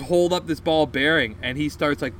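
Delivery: ordinary talk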